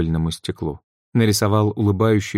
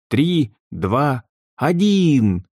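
countdown shout; 6 semitones higher, much slower than usual. A narrator's reading. Countdown before the school bell.

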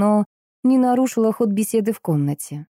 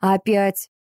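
displeased bark. A narrator's reading. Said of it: "недовольно рявкнула".

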